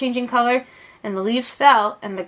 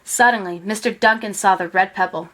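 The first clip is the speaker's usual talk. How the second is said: ordinary delivery, nothing marked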